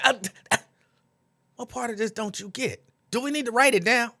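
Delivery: playfully mimicking the debate staff; mock debate moderator. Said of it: "and they'd say".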